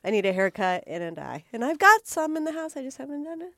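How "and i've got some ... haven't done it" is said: normal talking voice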